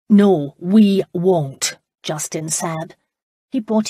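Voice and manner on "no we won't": slow, loud, dug-in no; stubborn refusal. Acted. Justin overruling his brother's breakfast order, slow and loud. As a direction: plant your feet and refuse each word.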